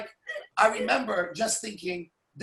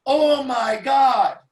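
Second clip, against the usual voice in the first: slow, louder, drawn-out "Oh my God"; stunned "oh my God"; 6 semitones higher, 6 dB louder, much slower than usual. Retold or imitated. Recalling the popcorn disaster, slow stunned dread. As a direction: stretch it out in dawning horror. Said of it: "I thought to myself".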